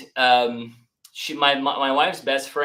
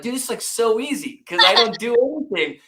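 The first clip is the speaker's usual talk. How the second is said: higher, louder, smug and breezy; cocky joke; 9 semitones higher, 5 dB louder than usual. Retold or imitated. Answering people who ask about Airbnb, smugly joking. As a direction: brag that it's effortless.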